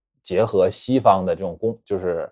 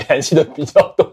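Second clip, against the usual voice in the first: ordinary talk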